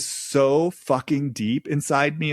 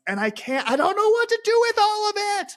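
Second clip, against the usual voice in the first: put-on much higher, panicked cry of a frightened girl; voicing Karen's overwhelmed fear, pitched way up; scared high plea; faster than usual. Channeling Karen's fear to viewers, high frightened plea. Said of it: "That's kinda what I hear Karen saying".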